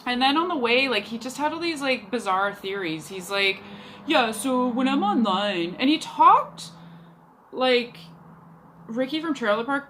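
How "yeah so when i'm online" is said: lightly imitated slack-jawed guy; dumb slack-jawed voice. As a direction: do a hint of a dim, slack-jawed date. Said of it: "slack-jawed kind of voice, that's how he talked".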